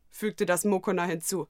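ordinary delivery

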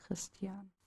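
very soft, lingering name; softly, dreamily. Acted. Smitten boy murmurs his rescuer's name, very soft. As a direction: breathe his name, wondering. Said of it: "name softly repeated, dreamy".

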